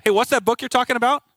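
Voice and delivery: high, loud voice butting in; piping up. Retold or imitated. Coworker interrupting a hallway talk about a book, piping up. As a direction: imitate someone cutting brightly into a conversation. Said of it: "she just pipes up".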